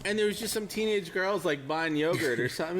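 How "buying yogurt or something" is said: ordinary delivery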